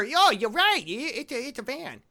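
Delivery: high, loud goofy nerd. Retold or imitated. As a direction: play a dopey know-it-all kid. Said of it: "that's my Griffin nerd voice".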